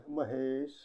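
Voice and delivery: says his name softly, almost under breath; tenderly calling his name quietly; soft gentle call. A narrator's reading. Yamini softly calls Mahesh's name, gentle and quiet. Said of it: "हळूच म्हणाली".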